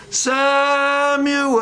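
high, long-drawn call. Acted. God calls to sleeping Samuel in the night, long high call. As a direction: stretch the name out on a high, held note. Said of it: "All the time it's".

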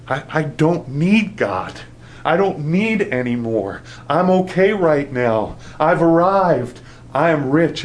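smug claim that God isn't needed; self-satisfied boast. Retold or imitated. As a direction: play the self-sufficient believer. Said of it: "you come to a place and you think".

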